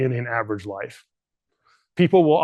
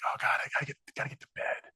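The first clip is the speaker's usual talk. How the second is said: tired whisper; 11 dB quieter, much faster than usual. Retold or imitated. Tired dad at night, retold.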